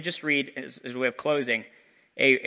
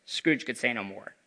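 ordinary delivery

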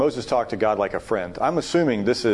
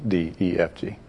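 ordinary delivery

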